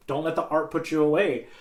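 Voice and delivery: normal talking voice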